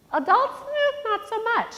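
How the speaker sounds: high, teasing knowing aside; wry aside. Real, not acted. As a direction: tease the grown-ups lightly. Wry aside about adults.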